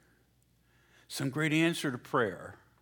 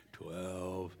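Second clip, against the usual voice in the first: lower, mumbled group reply; disciples in chorus; 6 semitones lower, 12 dB quieter than usual. Retold or imitated. A hint of disciples answering together. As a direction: answer like a sheepish crowd. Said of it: "all together going".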